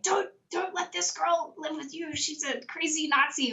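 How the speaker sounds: high, slow, put-on mocking voice; mocking group member. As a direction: mimic a preachy stranger warning people off. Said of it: "one person realized who I was and was like".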